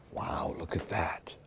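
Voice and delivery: hushed, amazed whisper; spooky wondering whisper; awed whisper. Real, not acted. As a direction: breathe it in wonder. Showing child spooky pop-up page.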